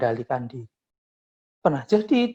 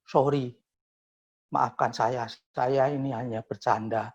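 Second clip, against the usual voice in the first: soft apology; 4 semitones lower, 6 dB quieter than usual. Retold or imitated. Arrogant friend hugs and apologizes.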